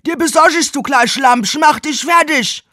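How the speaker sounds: loud hammy leer; leering workman. Acted. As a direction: play the porn stud.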